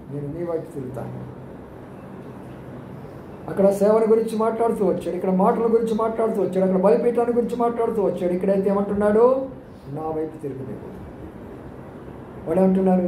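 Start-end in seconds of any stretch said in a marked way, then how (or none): 0.1-1.3 much softer and lower, a gentle whisper-like promise; tender promise. Acted. Pastor voices God's promise to the believer, softly and tenderly. 9.9-10.9 much softer and lower, gently pleading; tender call. Acted. Pastor voices God calling the sinner back, soft and tender.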